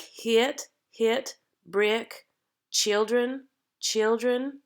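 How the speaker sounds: put-on hit, brick, children with a tight, forward short I; tighter forward vowel. Shown as a demonstration. Coach giving example words for the accent, tighter forward vowel. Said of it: "a little tighter sound".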